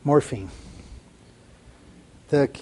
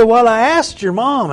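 emphatic quote; 8 semitones higher, 8 dB louder than usual. Retold or imitated. Pastor's reply voiced in story.

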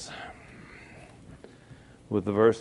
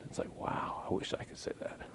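breathy hushed whisper of wonder; a quiet awestruck aside; awed whisper; 17 dB quieter than usual. Retold or imitated. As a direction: whisper it as if to yourself, amazed. Awed private thought.

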